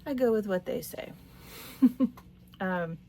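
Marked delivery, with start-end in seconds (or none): 1.4-2.2 higher, louder little laugh; chuckle. Real, not acted. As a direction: chuckle briefly at your own remark. Laughs after her own remark.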